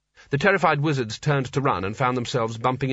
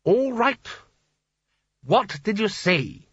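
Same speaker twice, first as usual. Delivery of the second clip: impatient wizard; 8 semitones higher, slower than usual. Acted.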